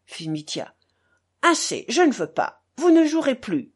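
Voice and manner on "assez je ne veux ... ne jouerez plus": raised, emphatic refusal; insistent forbidding. A narrator's reading. Kalganov stops Mitya from gambling, insisting. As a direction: put your foot down firmly.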